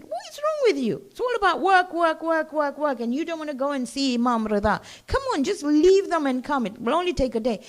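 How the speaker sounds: mock exasperation, work work work; exasperated scolding. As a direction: friends nagging the farmer to come along. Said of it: "they said".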